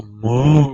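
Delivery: loud mock moo; cow moo ringtone. Imitating his cow-noise ringtone going off, loud moo. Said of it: "is a cow noise".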